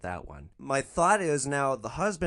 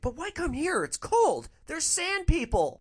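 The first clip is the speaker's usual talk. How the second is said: much higher, scoffing caricature of the passenger; scoffing lady voice; 3 dB louder than usual. Retold or imitated. Driver mimics scoffing passenger: high caricature voice. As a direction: scoff it up high like a clueless snob. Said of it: "she scoffs and she's like".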